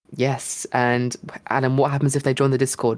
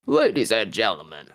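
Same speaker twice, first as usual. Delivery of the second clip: low, measured advert announcer; 3 semitones lower than usual. Shown as a demonstration. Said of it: "Do your advert voice".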